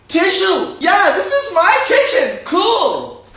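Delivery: put-on squeaky high kid voice; high silly kid voice. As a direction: show off the kitchen like a little kid. High character voice kitchen tour.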